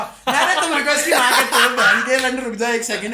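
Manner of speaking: high, very loud re-enacted shouting; furious swearing. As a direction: yell abuse while someone holds you back, comically. Re-enacts cursing while being restrained. (पकड़े जाने पर भी चीखकर गाली देने की नकल)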